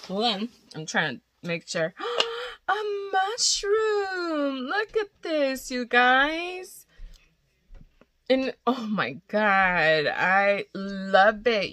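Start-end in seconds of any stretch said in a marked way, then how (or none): 2.0-2.6 sharp high gasp of delight. Real, not acted. Opening cute gift. 2.7-6.8 much higher, slow gushing squeal; excited squeal. Real, not acted. Squeals over cute mushroom. 8.3-11.7 slow, drawn-out gush of love. Real, not acted. Gushing over gift.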